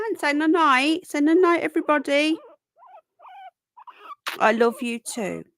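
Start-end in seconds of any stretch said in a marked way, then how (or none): 0.1-2.4 put-on high loud singsong baby talk; playful goodnight. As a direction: coax the pet to say goodnight to viewers. Telling pet to say goodnight.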